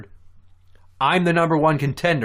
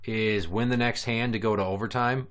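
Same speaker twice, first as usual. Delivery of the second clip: usual speaking voice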